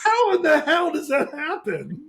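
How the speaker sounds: high, drawn-out disbelief; incredulous. Real, not acted. Cohost amazed at her family dinner invites, incredulous. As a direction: stretch the question out with pitch way up.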